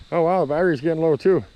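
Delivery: ordinary delivery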